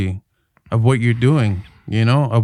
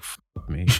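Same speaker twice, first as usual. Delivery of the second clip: muttered curse; 5 semitones lower, 14 dB quieter than usual. Retold or imitated. Hearing plates clink during his take.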